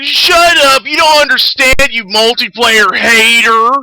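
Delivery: high, loud mock snap at a hater; mocking angry gamer. Mocking the fan telling the streamer off, high loud mock shout. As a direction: bark it like a furious fanboy, slowing at the end. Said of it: "He's like".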